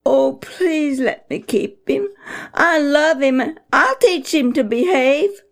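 put-on high, begging boy's voice; begging child. Boy begs his parents to keep Pogo. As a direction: plead to keep him, eager. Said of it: "Sammy begged to keep him".

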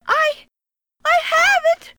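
put-on high, halting little boy; fearful child. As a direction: answer scared and unsure. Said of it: "the child's fearful voice".

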